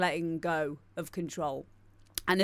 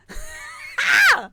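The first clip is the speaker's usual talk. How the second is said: burst of laughter; 6 dB louder than usual. Real, not acted. Laughing at own joke.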